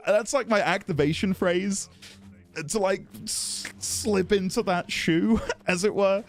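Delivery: normal talking voice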